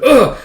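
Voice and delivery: high, loud grunt of impact; mock cry of being smacked; acted pain grunt. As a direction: imitate taking a hard hit. Retold grunt on being hit.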